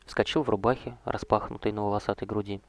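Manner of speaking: ordinary delivery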